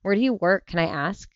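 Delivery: normal talking voice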